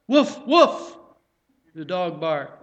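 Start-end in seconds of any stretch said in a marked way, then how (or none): 0.1-1.0 two high, loud yipping woofs; hammed-up doggy woof woof; double dog woof. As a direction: bark twice like an eager dog. Dog answers butcher in joke.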